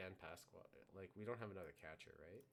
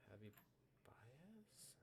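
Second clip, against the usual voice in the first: whispered; 5 semitones higher, 8 dB quieter, much slower than usual. Real, not acted. Quiet musing over card names.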